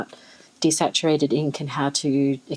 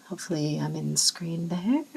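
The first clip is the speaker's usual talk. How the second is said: whispered aside; 6 dB quieter than usual. Real, not acted. Aside while filming.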